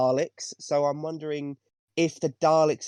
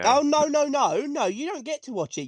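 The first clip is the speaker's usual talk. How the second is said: mock outrage; 11 semitones higher than usual. Real, not acted. Teasing a co-host who swore off the show, mock outrage. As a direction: cry no like a scandalised friend. Teasing co-host's change of mind.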